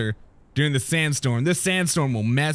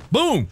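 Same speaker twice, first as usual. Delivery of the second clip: cannon boom; 7 semitones higher than usual. Pretend. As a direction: shout the boom noise. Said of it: "only works if you make the boom noise".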